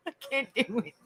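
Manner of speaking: real giggle breaking into the words; laughing refusal. Real, not acted. Speaker refusing something to viewers, helplessly laughing.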